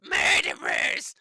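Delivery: airy wailing shriek of the word; mock screech. Commentator mimicking the protester again, slow airy screech. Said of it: "He sounds like Harvey Milk".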